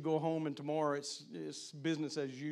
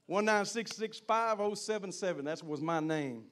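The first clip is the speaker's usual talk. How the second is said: ordinary talk, nothing marked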